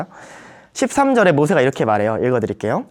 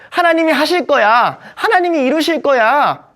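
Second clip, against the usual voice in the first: higher, louder, slowing assured declaration; mock confident Moses; 9 semitones higher, 4 dB louder than usual. Moses boasting of faith but not moving, mock confident. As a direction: proclaim it with showy faith. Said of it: "라고 말했는데".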